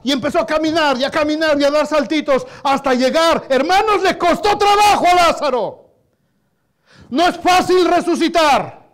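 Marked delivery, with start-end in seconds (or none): 3.5-8.9 louder, higher preaching voice; impassioned preaching. Real, not acted. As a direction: preach it with rising fervor. Predica con fuerza. (predicación ferviente)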